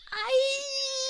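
very high, drawn-out "aïe" shriek; cry of pain. A narrator's reading. Pinched snake cries out, high yelp of pain. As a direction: squeal it out high and hold it. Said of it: "Le serpent cria".

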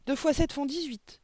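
put-on high, loud child's answer; cried out. A narrator's reading. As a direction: shout the answer in a piping voice. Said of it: "cria-t-il". (cri aigu d'écolier)

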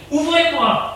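high-pitched call through a door; crying out. A narrator's reading. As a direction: yell to be let out, pitched high. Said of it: "Il crie". (cri aigu derrière la porte)

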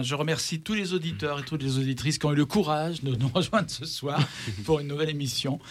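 ordinary delivery

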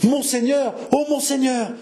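high, pleading cry of alarm; distraught stammer. Acted. Jeanne to monseigneur on hearing the prison order, distraught stammer. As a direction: call out in shocked distress. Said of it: "bégaya enfin Jeanne".

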